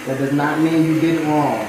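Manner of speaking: ordinary talk, nothing marked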